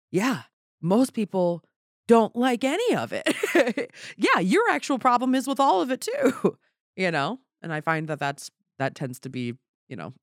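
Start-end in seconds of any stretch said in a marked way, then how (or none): 0.1-6.6 high, loud comic comeback; exasperated mock retort. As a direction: push the acted retort up high and emphatic. Acted retort to older people.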